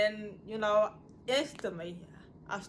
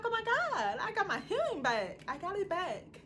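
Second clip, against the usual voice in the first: much higher, fast, bursting with joy; overjoyed child; 12 semitones higher than usual. Retold or imitated. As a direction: squeal it out like a kid who got her hearing back. Joy at hearing again as child.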